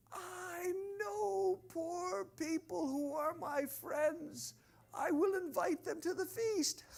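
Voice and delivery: high, drawn-out sing-song; lofty inner thought. Acted. Rich host thinks of inviting friends, high dreamy musing. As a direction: float it up high, musing to yourself. Voices his own eager thought.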